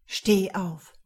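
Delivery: firm, hard command to stand; harter Befehl. A narrator's reading. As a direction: order her up sharply. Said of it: "verlangte er in so hartem Ton". (in hartem Ton befehlen)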